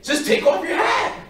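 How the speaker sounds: high, loud exasperated yell; acted-out frustrated shouting. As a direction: yell it at a kid who won't listen. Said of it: "Now I'm getting frustrated with this kid".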